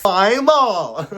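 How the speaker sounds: put-on a loud country-accent take on "Bible", drawn out in a Southern drawl. Vlogger slipping from a Kardashian bit into a country accent, loud. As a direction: twang the word "Bible" like a down-home country singer. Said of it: "I just went into the country accent".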